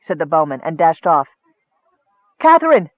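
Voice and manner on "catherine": loud, high urgent call; raised, high-pitched summons; crying out. A narrator's reading. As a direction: call out sharply for the maid. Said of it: "Nicole cried".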